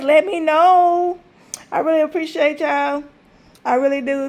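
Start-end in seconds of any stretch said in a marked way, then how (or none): 0.0-1.2 slow, stretched words to viewers; slow drawn-out ask. Real, not acted. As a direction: draw each word out slowly. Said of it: "drawn out request".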